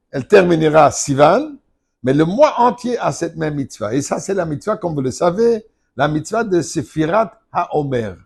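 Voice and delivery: normal talking voice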